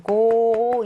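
"고" stretched into a long GOO; very drawn-out "고"; long vowel. Shown as a demonstration. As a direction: hold the vowel of "고" out. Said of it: "이렇게 길게 느려서".

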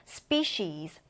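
"species" said SPEE-sheez, low and falling; sh sound, final z. Shown as a demonstration. Teacher demonstrating pronunciation, "species" as SPEE-sheez. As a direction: say "species" with C as sh and the last S as z. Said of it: "맨 끝에 S는 Z 발음을 해서요".

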